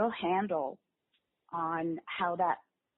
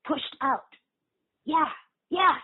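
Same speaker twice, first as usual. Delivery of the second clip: put-on little boy voice; 9 semitones higher, 5 dB louder than usual. Shown as a demonstration. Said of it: "the little boy is pushed out".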